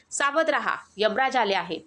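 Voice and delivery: ordinary delivery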